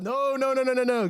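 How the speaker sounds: loud, high run of no's; emphatic refusal. Retold or imitated. Reviewer refusing the cult coming to England, loud and high. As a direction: shout the no's down hard. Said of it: "And I was like".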